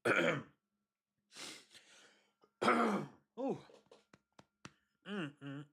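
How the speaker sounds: strained throat clearing and groans; recovering from a sip gone wrong; coughing up water. Real, not acted. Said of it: "do not breathe in while you're drinking".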